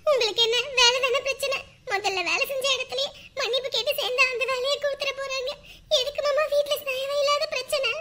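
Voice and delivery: high, plaintive crying voice; tearful pleading. Acted. Wife urging husband to apologise at work, tearful pleading. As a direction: reason with them through tears.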